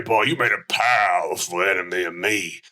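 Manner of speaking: ordinary talk, nothing marked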